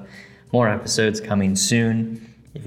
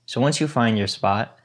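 guided meditation. Acted. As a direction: guide them gently into stillness.

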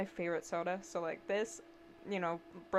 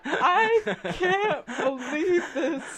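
disbelief; 7 semitones higher, 11 dB louder, much slower than usual. Retold or imitated. Dropped the milkshake again.

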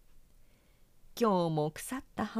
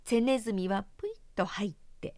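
ordinary delivery, nothing marked

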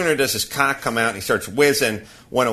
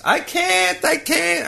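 put-on high, whining little-girl voice; whiny child protest; slower than usual. Dad mimics daughter refusing the hill, whiny high protest. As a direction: whine it like a kid refusing. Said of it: "she bitterly disputes this".

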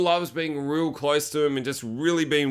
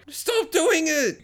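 very high, drawn-out whine; slow, high-pitched exasperation; mock exasperated plea; 14 semitones higher, much slower than usual. Viewer exasperated at character's antics.

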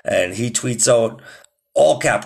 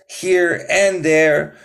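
higher, upbeat sign-off, half sung over the beat; podcast outro over music; 7 semitones higher than usual. Acted. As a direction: ride the beat and sign off to the listeners.

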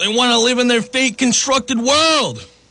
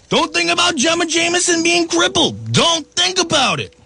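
high mocking singsong; mock the official line sweetly; sarcastic mock; 9 semitones higher than usual. Mocking pro-vaccine messaging.

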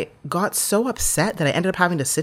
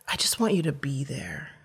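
whispered plea, gentle; tender whispered request; soft whisper; 5 dB quieter than usual. Retold or imitated. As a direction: breathe it to her. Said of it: "he was so soft".

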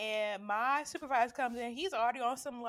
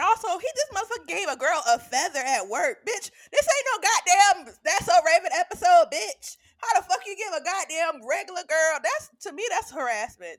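loud, high furious rant; outraged rant; 6 semitones higher, 13 dB louder than usual. Real, not acted. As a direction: vent the outrage at full volume. Outraged rant about coworker.

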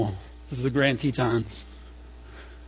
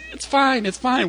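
hurried reassurance; 8 semitones higher than usual. Retold or imitated. Parents brushing off child's panic.

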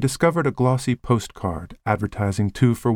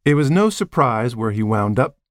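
ordinary delivery, nothing marked

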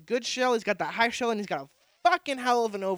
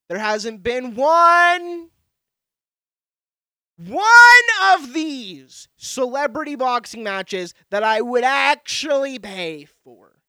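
loud, drawn-out rant, pitched up; emphatic outburst; 5 semitones higher, 13 dB louder, slower than usual. Real, not acted. Podcaster ranting about celebrity boxing matches, loud and exasperated. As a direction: stretch it out loud and high in exasperation.

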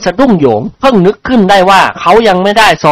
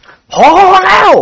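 high, stretched-out cry of protest; acted shout, drawn out rather than very loud; 3 dB louder, much slower than usual. A narrator's reading. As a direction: call a halt in a long high yell. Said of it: "สองเจ้าคุณตะโกนขึ้นพร้อมๆกัน".

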